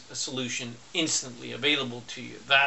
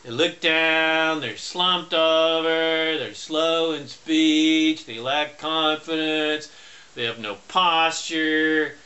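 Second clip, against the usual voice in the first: slow and deliberate; each phrase slowed right down; slow, heavy delivery; slower than usual. Shown as a demonstration. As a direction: drag it out slowly, like a slumped man. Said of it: "What is the physiology of a depressed person?".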